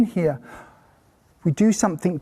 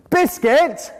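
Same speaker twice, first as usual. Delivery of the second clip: louder, higher insistent correction; loud drill; 6 semitones higher than usual. Shown as a demonstration. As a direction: bark the word at the child. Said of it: "demo of drilling".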